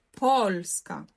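польська stressed on the first syllable, поль; наголос на перший склад. Shown as a demonstration. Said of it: "наголос на по". (польська з чітким наголосом на поль)

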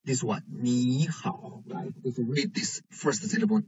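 ordinary talk

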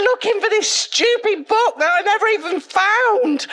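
high, loud, wailing astonishment; indignant excited outburst. Retold or imitated. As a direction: shout it out, overwhelmed and indignant at missing out. Retold outburst at missing Jesus.

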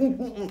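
soft wordless muffled grunts; mute man's grunts. Acted. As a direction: grunt softly, mouth closed. Mute man's wordless reply imitated.

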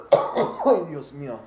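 slow, loud drawn-out exclamation; mock-dramatic Spanish gasp; dramatic Dios mío. Real, not acted. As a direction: groan it out theatrically. Exaggerated exclamation after coughing.

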